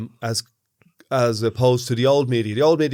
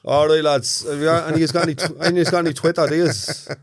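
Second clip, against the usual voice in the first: higher, rough northside Dublin accent; Ballymun accent; 4 semitones higher than usual. Shown as a demonstration. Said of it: "Use the voice that got you ran out of Ballymun".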